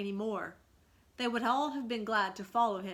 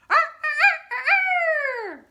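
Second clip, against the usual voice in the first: loud, high ringing crow call; rooster crow; 17 dB louder, much slower than usual. A narrator's reading. Peter signalling his return home, loud ringing crow. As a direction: crow like a triumphant cockerel. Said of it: "They heard Peter crow".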